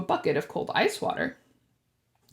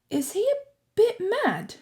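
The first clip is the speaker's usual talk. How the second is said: put-on higher, unsure boyish question; uncertain boy; 10 semitones higher than usual. A narrator's reading. Harry unsure, asking Percy about Dumbledore. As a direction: ask it hesitantly. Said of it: "he asked Percy uncertainly".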